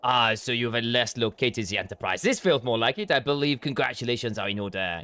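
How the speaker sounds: a courtly French noble bidding farewell; French accent. Acted. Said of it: "leave the French accent alone".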